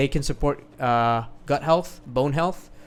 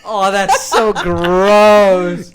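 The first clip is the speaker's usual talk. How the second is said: laughing disgust; 7 semitones higher, 15 dB louder, much slower than usual. Real, not acted. Mouth sounds on the mic.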